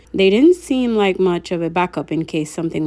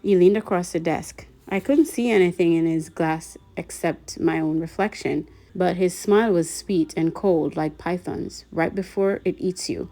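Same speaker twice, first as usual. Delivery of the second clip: ordinary delivery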